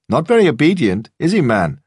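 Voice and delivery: loud taunting gym thug; sneering weightlifter. A narrator's reading. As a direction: needle the owner about his dog. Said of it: "the weightlifter said".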